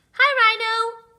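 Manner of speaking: high, loud cheery greeting; Gnu greeting. A narrator's reading. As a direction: call out a bright hello. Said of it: "greeted Gnu".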